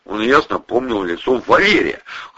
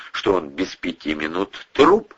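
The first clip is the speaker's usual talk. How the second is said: plain reading voice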